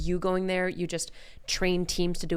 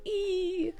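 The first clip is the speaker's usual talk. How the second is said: fearful squeak; 13 semitones higher than usual. Retold or imitated. Wincing at fighting tickets.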